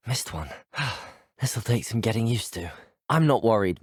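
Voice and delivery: ordinary talk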